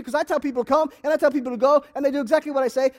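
ordinary talk

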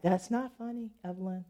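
very soft, trailing mock-stern scolding; quiet reproof. Man trying to be mean to her teasing, quiet mock reproof. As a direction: imitate a man scolding under his breath.

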